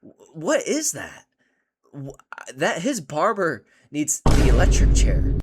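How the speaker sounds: high, loud, slow shock at the haircut; outraged disbelief. Real, not acted. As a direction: shout your disbelief as if you can't look away.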